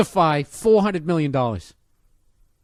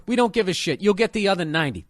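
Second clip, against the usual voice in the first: ordinary delivery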